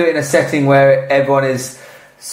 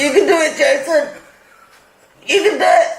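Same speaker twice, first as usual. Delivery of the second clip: high excited cheering; root for Jason like a fan in the stands; high-pitched mock cheer for Jason; 14 semitones higher than usual.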